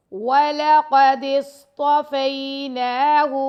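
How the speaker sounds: drawn-out tajweed recitation of وَلَقَدِ اصْطَفَيْنَاهُ; recited model. Shown as a demonstration. Teacher models the verse for students, in slow chant. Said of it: "Quran recitation lesson".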